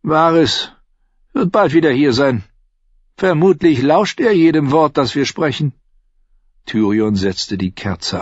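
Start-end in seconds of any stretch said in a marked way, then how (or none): 0.0-5.7 higher, pushed character voice; urgent warning. Acted. Warning a lover that Varys eavesdrops, urgent and higher. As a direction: warn of a listener, pitched up.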